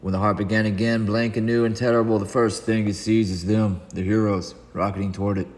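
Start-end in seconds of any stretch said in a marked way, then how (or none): none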